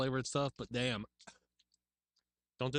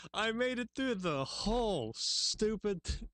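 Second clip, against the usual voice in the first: exasperated wail; 9 semitones higher, slower than usual. Real, not acted. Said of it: "Damn you, Cora".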